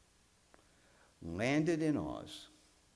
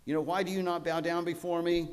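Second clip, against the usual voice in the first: higher, louder booming rebuke; threatening wizard; 6 semitones higher, 5 dB louder, faster than usual. Retold or imitated. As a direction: thunder down at them like an offended wizard. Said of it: "where the wizard is threatening".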